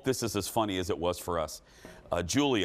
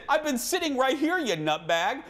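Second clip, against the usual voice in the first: much higher mock-indignant protest. Host defending himself to his friend, mock indignant. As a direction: play up the innocence. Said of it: "And I said".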